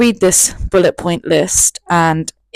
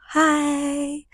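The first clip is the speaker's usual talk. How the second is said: high small voice; 9 semitones higher, 7 dB quieter than usual. Retold or imitated. Her own reply on the phone.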